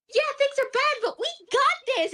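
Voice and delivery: squeaky brave-face optimism; cheery T-doll. Retold or imitated.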